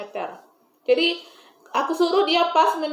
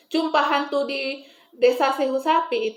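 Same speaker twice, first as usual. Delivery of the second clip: ordinary talk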